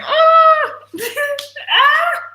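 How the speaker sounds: high loud squeal of disgust; squeal, skin crawling; disgusted shriek. Real, not acted. Word fester creeps her out.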